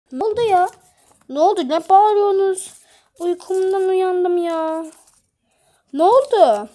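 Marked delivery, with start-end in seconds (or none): none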